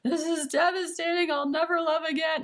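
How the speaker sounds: high, loud, over-the-top wail of heartbreak; mock melodramatic despair. Mocking a heartbroken viewer's overreaction with a high, loud wail. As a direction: ham up a tragic lovesick lament. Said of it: "rather than be like".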